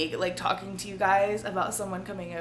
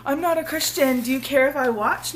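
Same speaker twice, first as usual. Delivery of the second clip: quoting a commenter; 5 semitones higher, 5 dB louder than usual. Retold or imitated. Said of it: "That was another comment".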